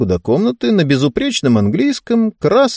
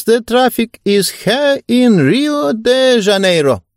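put-on old lady voice; 9 semitones higher, much slower than usual. Old lady speaks English.

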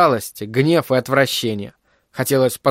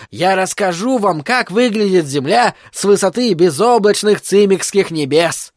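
high, theatrical storyteller voice; circus performer's patter; 6 semitones higher than usual. A narrator's reading. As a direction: declaim grandly to the paying crowd. Freak performs for the audience.

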